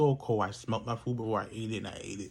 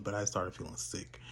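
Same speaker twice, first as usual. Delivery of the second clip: ordinary delivery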